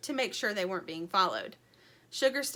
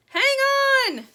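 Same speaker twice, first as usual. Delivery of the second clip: high, loud yell; chick yelling; 12 dB louder than usual. A narrator's reading. Dirt yells to the others to hold on, high and loud. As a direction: shout a warning as they climb. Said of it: "yelled Dirt".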